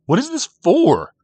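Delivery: high, loud, exasperated question; demanding. A narrator's reading. Boy waving compass at the effigy, demanding.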